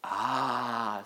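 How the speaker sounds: soft, wistful sigh of "ah"; gently mournful faun; melancholy. A narrator's reading. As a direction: let out a rueful, drawn-out sound. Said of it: "in a rather melancholy voice".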